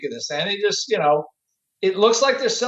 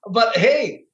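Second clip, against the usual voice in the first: ordinary talk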